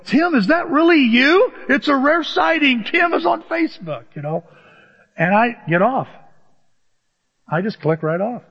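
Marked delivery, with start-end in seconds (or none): 0.0-4.0 higher, slowed teasing voice of a friend; surprised friend. Retold or imitated. Friend online teasing Tim for rare appearance, high and mock-amazed. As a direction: tease him like spotting a rare bird. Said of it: "somebody's gonna see me and go".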